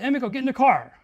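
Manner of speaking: teacher ordering an exhausted student into the car, loud; urgent plea. Retold or imitated. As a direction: tell her to get in the car, insistent. Teacher pleading with walking student.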